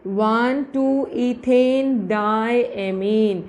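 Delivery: very slow, word-by-word dictation. Shown as a demonstration. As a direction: spell out the name slowly for note-takers. Teacher dictates the compound's name. (धीरे-धीरे बोलकर लिखवाते हुए)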